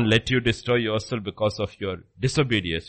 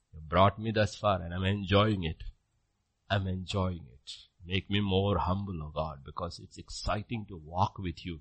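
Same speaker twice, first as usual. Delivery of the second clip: much softer and lower, tender; humble prayer; 6 semitones lower, 7 dB quieter than usual. Pretend. Preacher modeling a meek believer's prayer to God, soft. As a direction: pray it gently and humbly.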